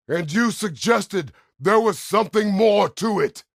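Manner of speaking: high, roaring accusation at full volume; angry shout. Acted. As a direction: throw the accusation out in a loud, furious yell. Angry confrontation over a suggestion.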